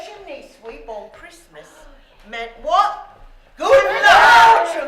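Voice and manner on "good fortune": loud, high, drawn-out exclamation; triumphant shout. Acted. Storyteller calls out what the chimney sweep means, triumphant shout.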